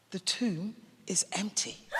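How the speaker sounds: soft, near-whispered wonder; hushed reveal. A narrator's reading. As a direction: drop to a hush for the revelation. Narrator reveals the empty tomb.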